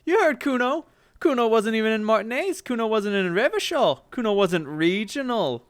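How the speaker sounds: put-on high, loud bratty boy voice; a cocky street kid boasting; bratty kid Kuno. Kid brags to the detective, high and bratty.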